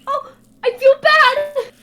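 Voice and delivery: high, loud, drawn-out wail of guilt; mock guilty cry. Player wailing after punching the dog. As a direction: cry out in hammed-up remorse.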